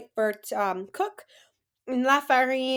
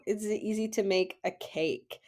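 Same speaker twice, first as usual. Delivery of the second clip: ordinary talk, nothing marked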